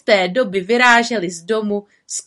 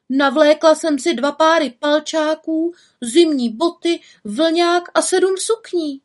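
put-on high, louder girlish protest; defensive little witch; 9 semitones higher, 4 dB louder than usual. A narrator's reading. Little witch protests to the raven, defensive. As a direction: plead that you did your best.

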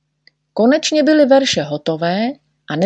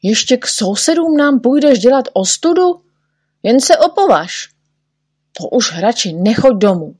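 threatening; 6 semitones higher, 4 dB louder than usual. A narrator's reading. Group threatens Neználek.

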